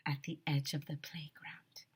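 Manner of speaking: breathy suspense whisper. A narrator's reading. A narrator hushing as the chase ends. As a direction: drop to a hush for suspense.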